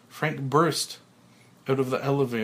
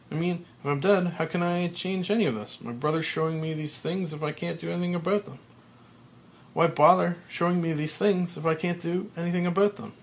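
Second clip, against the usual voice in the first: agitated protest; 6 semitones higher than usual. Acted. Frank protests to his brother.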